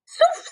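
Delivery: very high zuf; falling whoosh. Acted. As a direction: make the sound of it slipping. Pañal se cae. (efecto muy agudo)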